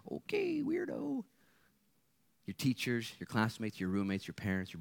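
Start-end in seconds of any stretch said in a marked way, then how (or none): 0.0-1.2 high, sneering mock voice on okay weirdo; mock sneer of a classmate; mocking scoffer. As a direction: scoff at the believer in a shrill-pitched put-on. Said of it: "somebody finds out".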